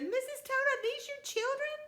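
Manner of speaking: very high startled question; grandma startled by five toads at her door; surprised. Acted. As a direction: gasp it out in disbelief. Nana sees five toads.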